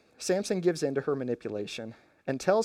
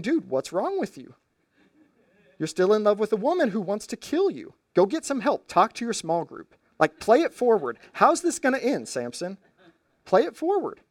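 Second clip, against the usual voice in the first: exasperated plea; 6 semitones higher than usual. Acted. Preacher pleading with Samson.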